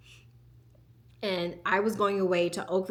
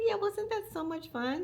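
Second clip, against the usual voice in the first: sweet coaxing mom voice; 12 semitones higher, 4 dB quieter than usual. Retold or imitated. Mother cheering up child.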